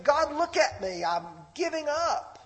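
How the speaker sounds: high-pitched acted cry to God; desperate plea, imitated. Preacher acting David giving up before God, desperate plea.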